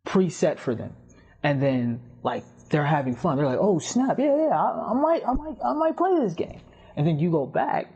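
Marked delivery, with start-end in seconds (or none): 3.6-6.6 very high-pitched mock excitement; giddy, stammering eagerness, hammed up; excited player. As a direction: squeal it like a newly hooked gamer. Acts out thrilled trial player.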